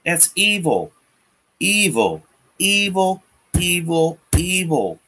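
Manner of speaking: each evil hit high and falling; repeated condemnation. Real, not acted. Ranter condemning child abusers, evil pitched high and falling. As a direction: hammer evil again and again.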